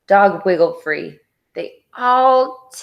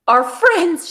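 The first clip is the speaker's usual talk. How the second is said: sudden high gasp of alarm; 10 semitones higher, 3 dB louder than usual. A narrator's reading. Dog realizing her friends are gone, gasping. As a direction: catch your breath on it. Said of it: "she gasped".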